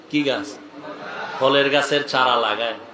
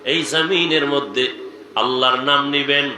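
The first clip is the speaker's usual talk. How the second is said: ordinary talk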